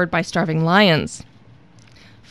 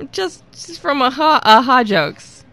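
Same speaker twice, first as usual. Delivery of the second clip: ordinary talk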